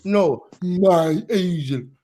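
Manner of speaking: slow, deliberate punchline correction; drawn-out correction. Acted. Correcting mishearing of Malaysian joke.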